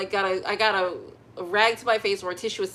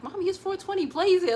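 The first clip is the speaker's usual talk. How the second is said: put-on much higher child voice; little son, much higher, cheeky; son's voice; 11 semitones higher, 4 dB louder than usual. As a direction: play the little boy cheekily. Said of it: "my son would be outside like".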